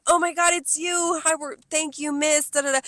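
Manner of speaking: high-pitched imitation of delighted regulars; gushing greeting. Regulars recognizing their server, high gushing greeting. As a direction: squeal it sweetly in mock delight.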